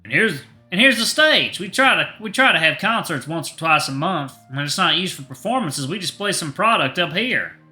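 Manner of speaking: lively Southern shopkeeper showing off the stage; Southern accent. Acted. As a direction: pitch up the Southern twang, eager host. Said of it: "he has a southern accent".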